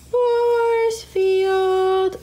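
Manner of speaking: high loud sci-fi announcement; dramatic force field call. Acted. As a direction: call it out like a superhero.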